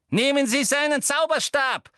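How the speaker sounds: high, loud command; sharp shouted order; barked order. A narrator's reading. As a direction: bark it at a subordinate. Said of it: "bellte sie jemanden an".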